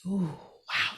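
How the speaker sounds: breathy hushed ooh, caught off guard; surprised whisper. Real, not acted. Guest whispers in surprise at the host's question.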